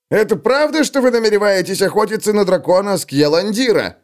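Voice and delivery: high, keen question; eager dwarf. Acted. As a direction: ask about the dragon hunt eagerly. (нетерпеливый вопрос гнома)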